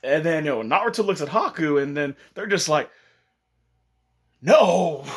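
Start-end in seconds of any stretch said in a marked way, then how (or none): 4.4-5.0 loud, high cry of denial; shouted, higher-pitched denial; emphatic denial. Retold or imitated. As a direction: blurt it out loudly in protest. Shocked denial in retold scene.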